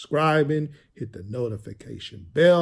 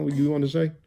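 ordinary talk

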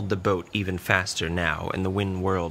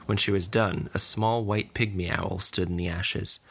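plain reading voice

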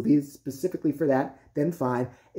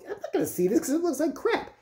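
mock moviegoer's refusal; 10 semitones higher than usual. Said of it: "movies with men that is sit there and go".

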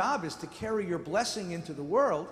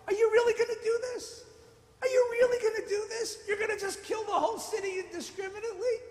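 much higher, fast disbelieving challenge; incredulous Abraham; 13 semitones higher, faster than usual. Retold or imitated. Abraham paraphrased as a startled challenge to God, much higher. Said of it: "look at that speech again".

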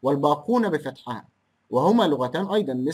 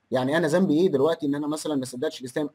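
ordinary talk, nothing marked

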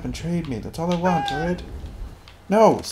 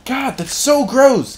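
disgusted; 9 semitones higher, 4 dB louder, slower than usual. Real, not acted. Disgusted by animation.

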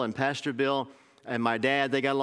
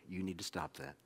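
hushed warning; 5 semitones lower, 12 dB quieter than usual. Real, not acted. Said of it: "the same warning repeated".

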